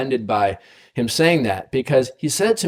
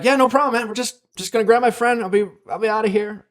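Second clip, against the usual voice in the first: high, breezy mock-casual reassurance; imitating his own easygoing brush-off, pitched high; to the cop, retold high and placating; placating reply; 10 semitones higher, faster than usual. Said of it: "And I'm like".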